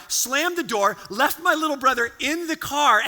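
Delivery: normal talking voice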